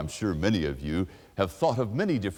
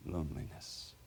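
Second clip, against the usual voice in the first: ordinary talk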